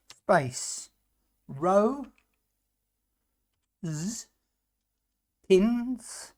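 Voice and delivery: slow word-by-word dictation; dictating. Shown as a demonstration. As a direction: spell out code piece by piece. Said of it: "dictating code word by word".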